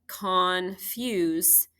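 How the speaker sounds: confuse drawn out slowly, split as con and fuse; slow teaching demo of confuse; slow syllable split. Shown as a demonstration. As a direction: stretch confuse so the syllable break is heard. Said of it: "is where I divide my syllables there".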